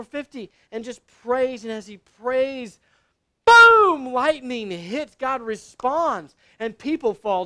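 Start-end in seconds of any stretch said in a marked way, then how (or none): 3.4-4.1 high, full-force shouted blast; explosive boom. Acted. Preacher showing lightning hit as prophet prays, explosive shout. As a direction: let it erupt loudly. Acts out lightning answering prayer.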